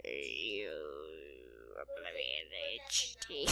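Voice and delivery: slow, drawn-out, hushed menace; low, creeping villain drawl; sinister threat. Acted. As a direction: stretch every word into a death threat. Slow acted death threat.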